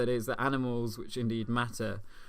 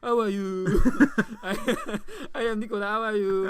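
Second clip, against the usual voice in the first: high, fast dopey French footballer voice, much higher and louder; dim footballer voice; 10 semitones higher, 5 dB louder than usual. Pretend. Mocking Anelka as dim to questioning officials, high dopey voice. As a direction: play him as cheerfully clueless.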